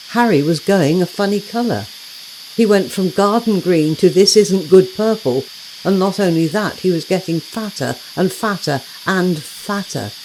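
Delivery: ordinary delivery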